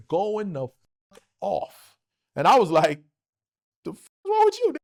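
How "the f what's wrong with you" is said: high-pitched incredulous retort; retold disbelief, voice way up; baffled indignation. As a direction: react affronted, pitch leaping up. Retold reaction to insulting message.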